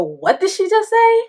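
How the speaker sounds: high, loud alarmed dad yelling from the living room; a father shocked at what he just heard. Retold or imitated. Dad overhearing the walkie-talkie, high and loud in alarm.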